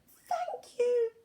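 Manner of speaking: put-on very high squeaky baby mice; tiny mice squeaking their thanks. A narrator's reading. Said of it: "they squeaked".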